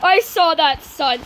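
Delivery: normal talking voice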